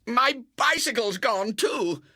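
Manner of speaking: high, slow wailing cry; a boy wailing over his lost bike. Acted. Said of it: "From the garden came a wail".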